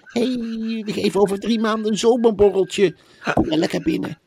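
high, put-on coaxing voice, often half-whispered; mock eager publisher, coaxing. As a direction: woo the authors in a high, sugary sales voice. Plays a publisher wooing authors.

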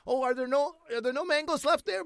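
feigned innocent asking. Retold or imitated. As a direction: imitate dad angling for the last ones, voice way up. Imitating dad angling for the mangoes.

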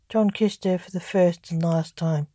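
ordinary delivery, nothing marked

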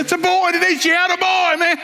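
re-enacted high-pitched, jubilant holler; excited hollering. Father announcing a boy in delivery room, excited hollering. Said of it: "I'm hollering, jumping around the room".

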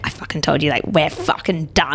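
ordinary delivery

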